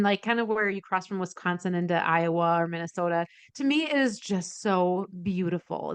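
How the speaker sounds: ordinary talk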